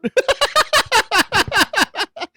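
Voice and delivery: long high-pitched laugh; high helpless laughter. Real, not acted. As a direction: crack up in a drawn-out giggle. Laughing at own blunder.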